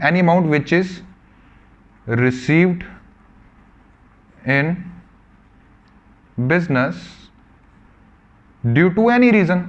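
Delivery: any amount which is received in business due to any reason, word by word with long pauses; teacher dictating to the class; slow dictation. Shown as a demonstration. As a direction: dictate each word slowly for notes. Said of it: "समझना बच्चों ढंग से".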